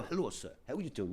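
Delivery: softly imitated humble greeting; hushed, polite mock greeting. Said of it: "he was just so humble".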